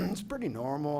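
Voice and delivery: ordinary delivery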